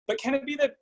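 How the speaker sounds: usual speaking voice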